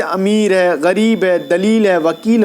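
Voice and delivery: "امیر، غریب، دلیل، وکیل" with a stretched ee, pitched low; drawn-out long i. Shown as a demonstration. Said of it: "جو کھینچ کر پڑھی جائے".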